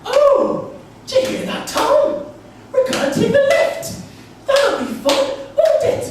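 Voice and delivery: very high, loud, gushing mum; shrieky falsetto mum jollying her son along; over-enthusiastic mum. Retold or imitated. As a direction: squeal with hammed-up motherly delight. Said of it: "over-friendly, so over-enthusiastic".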